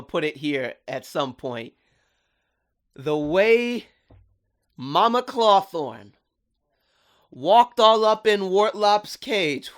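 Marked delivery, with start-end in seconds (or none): 2.9-9.7 slow, high, loud, hyped-up buildup. Real, not acted. Fan hyping the best scene to viewers, slow and loud. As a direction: savor every word like the best moment ever.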